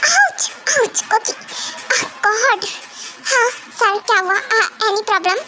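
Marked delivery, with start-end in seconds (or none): 0.1-1.0 high repeated yelps; mock ouch. Husband kicked again by jealous wife, yelping. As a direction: yelp twice in pain.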